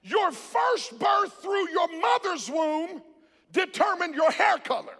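rousing, driving sermon delivery; full-force preaching. Real, not acted. Preacher urging the church to praise God, full-force preaching. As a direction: preach it out to a congregation.

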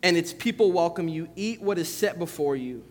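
measured, solemn Bible reading; scripture reading. Acted. Pastor reading the Gospel to the congregation, solemn reading.

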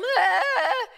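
loud high yell; scream. Retold or imitated. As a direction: yell at the bear. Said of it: "and I'm".

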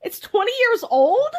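high disbelieving questions; astonished, voice shooting up; surprised. Real, not acted. Shock at the film's age.

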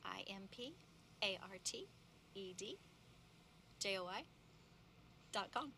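letter by letter with pauses; spelling it out. Shown as a demonstration. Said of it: "Will you spell it for me?".

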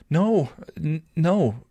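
high-pitched, stammered no; firm denial. A narrator's reading. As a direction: deny it firmly, tripping on the word. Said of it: "Tara firmly denied".

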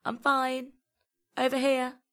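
high-pitched call to show where you are; calling back. A narrator's reading. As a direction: call out to a friend across the tracks. Calling back to worried friend.

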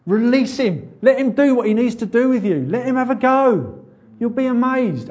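high, loud, fervent preacher's plea; preaching at full force. Acted. Preacher urging the congregation to release the Spirit, loud and high.

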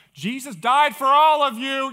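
high, booming evangelist shout; shouted gospel line. Retold or imitated. Pastor seizing the moment to give the gospel, shouting. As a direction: belt it out to the whole crowd. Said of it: "I'm like this. I'm like".

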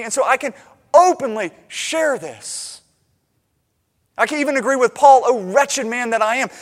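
loud, driving sermon delivery; impassioned preaching. Acted. Preacher pleading to be secure in Jesus, impassioned. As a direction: preach it with rising fervor.

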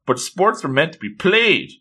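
acted, upbeat pitch to join in; eager urging. Acting a girl urging him.

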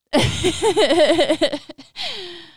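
bursting out laughing; big genuine laugh; loud real laugh. Real, not acted. Host cracks up after story.

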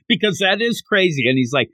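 normal talking voice

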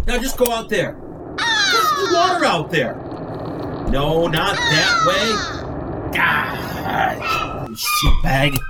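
high, strained yelling out the window; frustrated shouting. Real, not acted. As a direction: yell at it in exasperation. Yelling at a bird outside.

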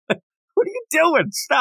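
high, drawn-out disbelief at the character; exasperated. Real, not acted. Host reacting to Denji blowing the trick, high exasperated plea. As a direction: cringe and beg him to stop.

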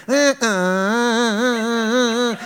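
1 stuttering engine. Retold or imitated. Car stuck in second gear.

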